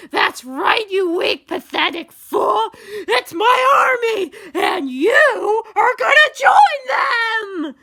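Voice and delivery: high, screaming villain rant; gloating villain. A narrator's reading. X threatens Steve with his army, shouting in triumph. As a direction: roar the taunt, building to the end.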